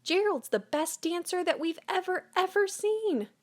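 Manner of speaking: high, astonished exclaiming; amazed shout. Acted. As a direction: cheer it like you can't believe it. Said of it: "They shouted".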